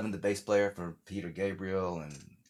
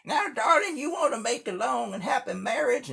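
put-on old country grandma; 3 dB louder than usual. Grandma advising the new bride, high sweet granny voice. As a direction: play a ninety-year-old Southern grandma. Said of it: "She's like".

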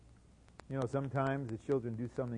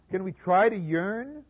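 mocking; 7 semitones higher, 9 dB louder than usual. Mocking the idea of yearning class.

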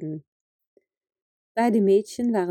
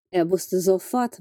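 usual speaking voice

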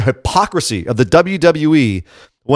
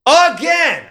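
AGAIN pitched way up and stretched; exasperated outburst; 14 semitones higher than usual. Real, not acted. Podcaster ranting about another catty women's storyline, exasperated. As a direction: let exasperation send the word sky-high.

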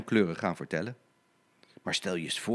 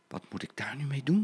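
soft whisper to herself; whispered thought; 3 semitones higher, 4 dB quieter, faster than usual. Acted. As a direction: whisper worriedly. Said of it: "dacht Blauw Katrientje".

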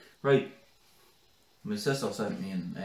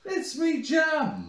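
high, slow mock sing-song; 4 dB louder, much slower than usual. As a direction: parrot the phrase mockingly. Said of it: "that was weird".

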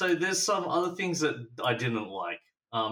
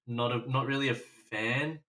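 usual speaking voice

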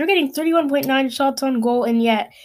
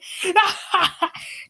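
high burst of laughter; mocking laugh; 9 semitones higher than usual. Real, not acted. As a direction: laugh scornfully at the number. Mocking the power play stat.

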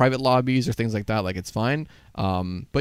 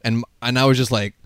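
usual speaking voice

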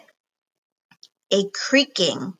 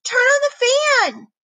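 high, urgent shout; panicked shout; 14 semitones higher, 4 dB louder than usual. Acted. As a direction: yell for the fan in panic. Panicked order during ghost arrival.

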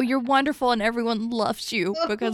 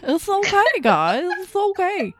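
much higher, louder put-on coo; goofy comfort voice; 10 semitones higher, 4 dB louder, slower than usual. Host does her silly voice for the audience, high coo. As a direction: sing it out like a goofy cartoon comforter. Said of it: "one of the voices that I do".

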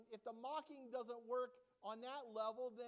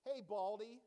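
put-on jeering call of a kid; loud schoolyard jeer; mocking taunt; 7 dB louder than usual. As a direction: taunt him like a schoolyard bully. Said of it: "the way that they mock Elisha".

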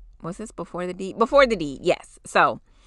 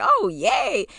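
higher, bright little cheer; mock cheer; 10 semitones higher than usual. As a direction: cheer along playfully. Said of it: "they were just like".

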